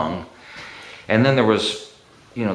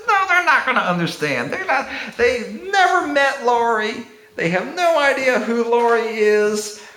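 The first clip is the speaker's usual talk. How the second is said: high-pitched, half-laughing protest; mock exasperation. Landlord arguing tenants never met Laurie, exasperated. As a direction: argue back in amused exasperation, pitched way up. Retold exasperated argument back.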